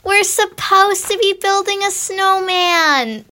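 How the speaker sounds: pleading little sister wanting to play; young Anna. Acted. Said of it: "Young Anna sounds like this".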